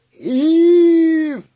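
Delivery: long, high, very loud shout; drawn-out hauling bellow; leader's roar. A narrator's reading. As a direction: roar the order to the team. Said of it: "roared the leader again".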